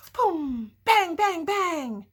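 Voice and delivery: high, loud booming bangs. Acted. Reader voicing the rabbit banging the trash can, loud. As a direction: pound out each bang. Reading banging noises aloud.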